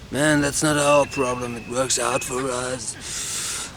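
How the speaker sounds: strained stoner voice, slowing and fading at the end; stoned smoker. Acted. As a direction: speak on a held lungful of smoke. Smoker answers while holding smoke.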